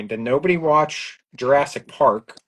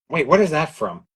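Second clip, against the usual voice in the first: normal talking voice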